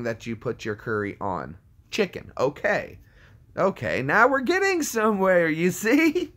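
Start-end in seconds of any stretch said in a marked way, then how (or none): 4.0-6.3 high squeaky voice rising as it goes; mock triumph. Streamer crowing to chat about getting somewhere, high mock triumph. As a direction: shoot up high and loud in comic triumph.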